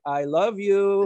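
slow, sweetly drawn-out I love you; imitating the sweet mother. Imitating the Korean mother calming the angry man, slow and sweet. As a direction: coax him gently like a soothing mother. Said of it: "doing that little thing where she was like".

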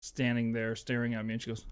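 ordinary delivery

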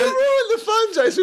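very high laughing complaint. Real, not acted. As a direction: squeal it through laughter. Guest laughing at a spoiled round.

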